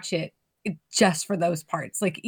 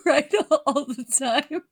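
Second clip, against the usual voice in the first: talking through real laughter; laughing; 5 semitones higher than usual. Real, not acted. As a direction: crack up while owning up to it. Laughing at own stubbornness.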